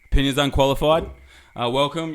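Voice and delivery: sexy husky podcast voice. Real, not acted. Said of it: "my sexy husky voice on".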